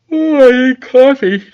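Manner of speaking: high, loud talk through a yawn; words stretched open by a real yawn. Real, not acted. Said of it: "I am so sorry that I'm yawning".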